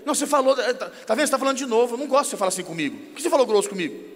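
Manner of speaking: mock spouse rattling off complaints; acted indignant bickering. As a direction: pile on the reproaches without a breath. Acted marital quarrel at its peak.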